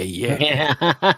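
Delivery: rising, laughing taunt; mocking laugh. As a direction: cackle as the taunt climbs. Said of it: "he's like".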